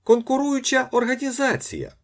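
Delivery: very high, loud exclamation; friendly shout. A narrator's reading. Ostap hailing his rival, friendly shout. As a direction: cry it out high like a gleeful announcement. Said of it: "доброзичливо закричав Остап".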